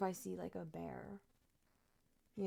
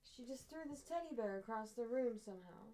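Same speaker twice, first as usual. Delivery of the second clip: usual speaking voice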